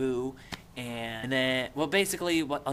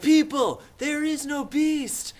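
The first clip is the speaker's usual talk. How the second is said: high, loud, stretched announcement; urgent mock cry; 14 semitones higher, 8 dB louder, slower than usual. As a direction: call out desperately to the crowd. Simon bursts in, acted.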